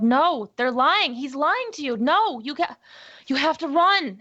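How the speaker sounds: shouting through the glass at mother; desperate yell. Acted. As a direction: yell a frantic warning. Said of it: "I just yelled".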